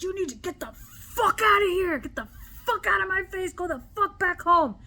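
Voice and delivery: high-pitched, furious retold rant; full of rage. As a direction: rage building into a loud order. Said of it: "full of rage".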